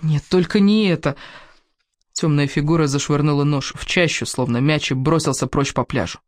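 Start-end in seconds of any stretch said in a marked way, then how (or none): 0.0-1.2 higher, anguished moan. Acted. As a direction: moan in helpless horror. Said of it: "простонала я, не в силах даже закричать". (горестно, со стоном)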